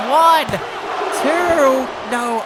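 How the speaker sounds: high, loud count to two; excited count. Real, not acted. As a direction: call the near fall. Near-fall count in live match.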